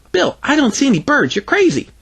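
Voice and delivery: put-on high-pitched child voice; dismissive little girl. Greta sees no birds, high dismissive kid voice. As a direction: protest high and girlish. Girl contradicts her friend.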